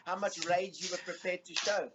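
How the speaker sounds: laughing while talking. Real, not acted. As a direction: chuckle through the words. Laughing at own story.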